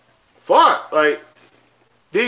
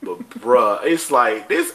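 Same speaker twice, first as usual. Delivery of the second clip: usual speaking voice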